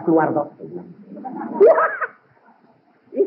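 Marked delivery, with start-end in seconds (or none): none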